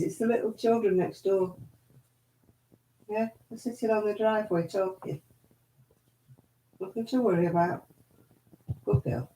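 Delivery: soft reassuring murmur to the dog; soothing. Real, not acted. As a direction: settle the frightened dog gently. Soothing the dog about the kids.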